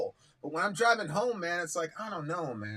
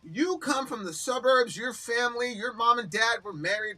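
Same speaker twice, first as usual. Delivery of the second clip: heated rant; 5 semitones higher than usual. Retold or imitated. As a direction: tear into him, voice up and pushing. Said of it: "But I'm like".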